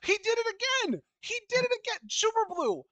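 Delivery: high-pitched exasperated complaint; exasperated outcry. Real, not acted. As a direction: cry out in disbelief at a repeat offender. Exasperated at yet another booker question.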